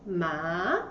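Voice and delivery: "Ma" sweeping upward in pitch; second tone. Shown as a demonstration. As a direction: say "Ma" in Mandarin second tone, rising to the end. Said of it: "the tone goes up".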